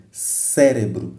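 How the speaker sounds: slow "cérebro", weight on CÉ; slow teaching demo, CÉ-re-bro; stress on first syllable. Shown as a demonstration. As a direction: stretch and push the CÉ of "cérebro". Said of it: "é no C onde usa-se mais força".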